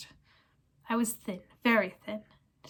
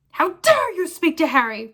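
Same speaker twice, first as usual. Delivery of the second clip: loud, high furious roar; 7 semitones higher, 9 dB louder than usual. Acted. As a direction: blast him with fury.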